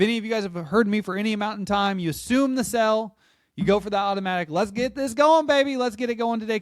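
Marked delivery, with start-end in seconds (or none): 4.5-6.6 eager push. Retold or imitated. Agent pushing the close.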